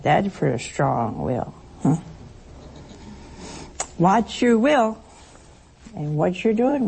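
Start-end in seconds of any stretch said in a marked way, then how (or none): none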